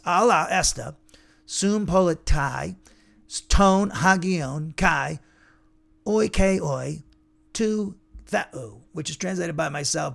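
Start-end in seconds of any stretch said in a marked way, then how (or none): 0.0-2.8 "sum politai" spelled out slowly and firmly, syllable by syllable; slow careful Greek. Shown as a demonstration. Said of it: "So it's". 3.5-8.8 drawn-out Greek recitation with long pauses; slow careful Greek. Shown as a demonstration. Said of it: "So it's".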